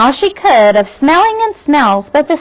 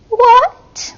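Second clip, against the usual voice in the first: pitched up in questioning; high puzzled query; 11 semitones higher than usual. A narrator's reading. Ada querying her father, high and wondering. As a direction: ask it with a high lilt. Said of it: "Ada queried".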